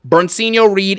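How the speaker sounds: usual speaking voice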